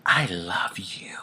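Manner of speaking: a real breathy whisper, slow to start; hushed whisper to the patient behind the curtain. Retold or imitated. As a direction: whisper it like a secret confession. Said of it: "she whispered".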